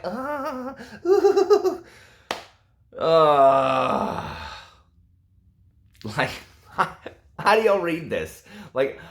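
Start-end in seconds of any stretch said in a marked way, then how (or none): 0.0-4.6 high squeaky exaggerated ahs and oohs; mock moaning. Reviewer mocking the heroine's swooning, with high hammed-up moans. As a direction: ham up her swooning moans. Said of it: "and she's all like".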